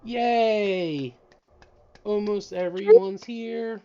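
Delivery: high, loud cheer. Real, not acted. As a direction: celebrate the arrival. Cheering arrival.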